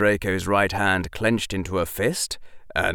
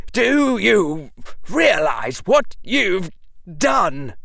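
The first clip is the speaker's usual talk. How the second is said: furious; 11 semitones higher, 5 dB louder, slower than usual. Acted. Draco furious at Harry.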